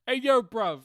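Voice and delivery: high, loud London roadman; roadman British voice. Shown as a demonstration. Co-host showing off birthday greeting, roadman British voice. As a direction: hype him up in a British roadman accent. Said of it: "I could do a roadman British voice".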